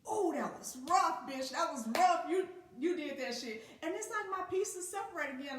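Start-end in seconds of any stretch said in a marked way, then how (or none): 0.1-3.7 proud self-hype. Retold or imitated. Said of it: "I'd be like".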